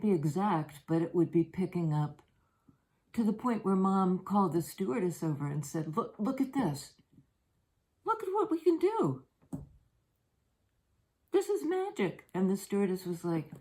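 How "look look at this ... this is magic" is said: much higher, thrilled mom's voice; giddy proud mother; excited mom. Retold or imitated. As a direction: show off the magic, all wonder. Mom showing off to stewardess.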